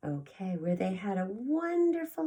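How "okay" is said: ordinary delivery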